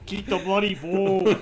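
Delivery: put-on loud, drawn-out Australian accent. Joking with podcast friends, hammed-up Australian accent. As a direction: ham up a slow, laughing Australian yell. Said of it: "stuck in your Australian accent".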